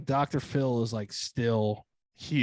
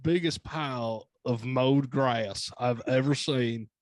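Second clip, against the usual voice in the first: put-on slow, deliberate Southern-accent put-down; pompous, slow TV-host drawl with a Southern accent; Dr. Phil; slower than usual. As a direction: draw out each insult in a mock Southern accent. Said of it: "Doctor Phil kinda lose his shit in that accent".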